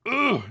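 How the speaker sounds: loud high ugh of frustration; frustrated groan. Real, not acted. Streamer groans, fired up about drained wallets, loud frustrated ugh. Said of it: "that gets me fired up".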